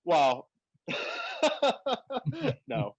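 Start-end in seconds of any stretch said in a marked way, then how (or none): 0.9-2.6 a high, delighted burst of laughter. Real, not acted. Laughing about the woman in the coffee shop, high and delighted.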